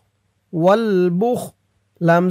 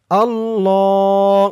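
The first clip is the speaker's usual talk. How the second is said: recited slowly; much slower than usual. Shown as a demonstration. Teacher showing pronunciation to viewers, slow chant. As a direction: stretch it out in a chant. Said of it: "আমি যেটা বলে দিব, আপনারা সেটা বলবেন".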